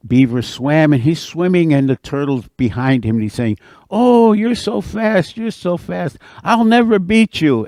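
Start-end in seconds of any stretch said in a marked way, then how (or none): 3.9-7.7 mock-awed turtle. Turtle flatters the beaver during the race, mock-despairing. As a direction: gush in a high voice, putting it on.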